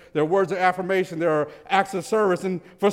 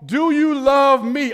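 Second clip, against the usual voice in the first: "Do you love me?" slow and loud, with weight on "Do"; emphatic question; 5 semitones higher, 5 dB louder, slower than usual. Shown as a demonstration. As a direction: drive "Do you love me?" home slowly, hitting "Do". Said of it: "the emphasis on".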